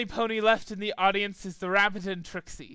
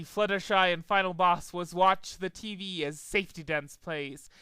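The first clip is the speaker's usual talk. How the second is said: usual speaking voice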